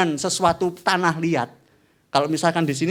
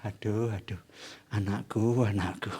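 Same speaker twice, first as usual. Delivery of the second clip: lower, much softer lament; sorrowful sigh; 9 semitones lower, 10 dB quieter than usual. Acted. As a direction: grieve over a wayward child. God sighing over his child.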